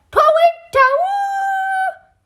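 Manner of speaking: high, slow hooting owl cry; owl call. Acted. Great uncle hooting like an owl to signal the coast is clear. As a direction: hoot it like an owl, long and high. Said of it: "imitating the call of an owl".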